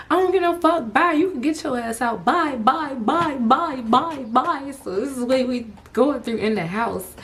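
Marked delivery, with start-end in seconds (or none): 0.1-4.7 mock yell. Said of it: "he's yelling at me from the doorway".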